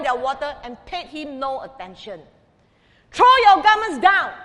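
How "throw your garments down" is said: loud, high, cross command; cross demand. A narrator's reading. Vain donkey crossly ordering the townspeople, loud and high. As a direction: bark it out like a vain, offended donkey. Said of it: "the donkey said crossly".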